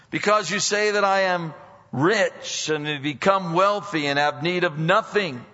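loud pulpit scripture reading; preaching. Acted. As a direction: proclaim the rebuke with force. Reading scripture at full force.

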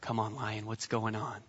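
a hushed, lazy couch-potato grumble; bored husband. Retold or imitated. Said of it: "as a guy".

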